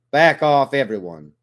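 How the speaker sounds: loud, sharp command to back off; snapped order. A narrator's reading. Narrator breaks up the fight among his squad, loud snap. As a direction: bark it to stop the brawl. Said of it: "I snap".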